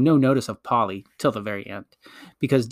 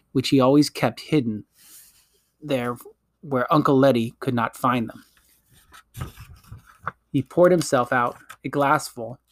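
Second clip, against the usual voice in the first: ordinary delivery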